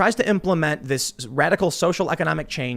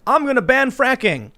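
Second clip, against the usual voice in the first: high, loud, slow mock pledge; drawn-out campaign declaration, pitched way up; Joe Biden; 10 semitones higher, 5 dB louder than usual. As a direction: proclaim it like a mocked candidate on the stump.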